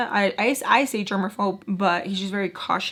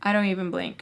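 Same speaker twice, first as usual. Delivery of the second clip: ordinary delivery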